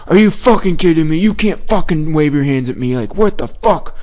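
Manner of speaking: imitated irate rant, pitched high; high-pitched retold outrage; fired-up guy cursing. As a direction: mimic an outraged stranger swearing at you. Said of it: "he just kept M F ing me like crazy".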